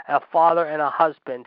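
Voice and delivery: ordinary talk, nothing marked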